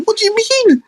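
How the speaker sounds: very high squeaky mum voice; mum squeaks. Retold or imitated. Mum squeaking back at the kids, high and shocked. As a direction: squeak it in disbelief. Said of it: "my mum was like".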